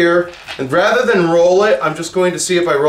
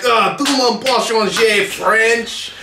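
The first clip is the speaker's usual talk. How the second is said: mock French accent; 6 semitones higher, slower than usual.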